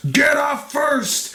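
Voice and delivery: high, drawn-out mock yell; imitated corner shout. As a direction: holler it slowly like a cornerman. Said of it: "As Vitor's corner shouts".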